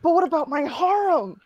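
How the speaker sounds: high-pitched affronted protest; indignant. A narrator's reading. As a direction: ask it in hammed-up outrage. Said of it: "I asked indignant".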